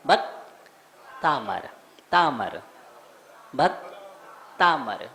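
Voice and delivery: भक्तामर slowly, broken as bhakt, then long taa-mar; correct pronunciation. Shown as a demonstration. Teacher drilling the hymn's title for students, correct pronunciation. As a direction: drill भक्तामर in two measured parts. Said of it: "क्या है?".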